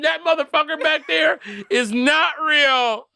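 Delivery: high, slow, dragged-out terrified claim; horrified insistence. Pretend. Girl on the plane telling everyone about the seatmate, high slow horrified voice. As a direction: spell out the horror word by word.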